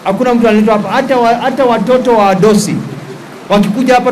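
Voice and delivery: usual speaking voice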